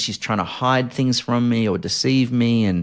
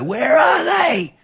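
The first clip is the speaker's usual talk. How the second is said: high frantic shout, drawn out; frantic cry; 3 dB louder, slower than usual. Retold or imitated. Shouting at his wife, sure intruders are in the house, frantic. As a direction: cry out, panicked, hunting for intruders. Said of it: "I'd come out and go".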